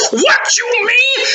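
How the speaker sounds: high, slow furious cry; furious king. Acted. The furious king hearing of the defiant boys, high slow cry. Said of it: "got furious".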